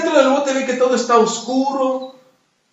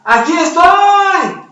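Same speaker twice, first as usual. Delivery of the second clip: high, drawn-out frog's shout; calling out; 8 semitones higher, 4 dB louder, much slower than usual. Acted. Trapped frog calls to its friends outside, calling out. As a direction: holler it out, stretching the last word. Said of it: "les grita la otra rana".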